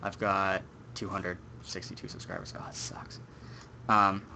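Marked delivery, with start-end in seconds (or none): 0.9-2.5 whispered under the breath; barely voiced confession; whispering. Real, not acted. Admitting low subscriber count. 2.6-3.2 whispering. Real, not acted. Mutters about low count.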